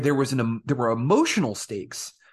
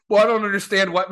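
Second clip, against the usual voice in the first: higher, louder nerd whine; mock fanboy whine; 7 semitones higher, 5 dB louder, faster than usual. Mocking a confused fanboy: higher, louder whine. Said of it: "And you can never be like".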